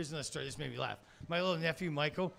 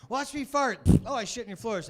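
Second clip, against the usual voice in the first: mock kid; 7 semitones higher, 7 dB louder than usual.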